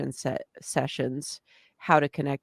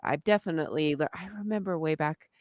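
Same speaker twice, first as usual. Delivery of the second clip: ordinary talk, nothing marked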